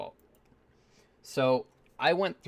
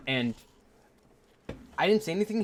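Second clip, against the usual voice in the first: usual speaking voice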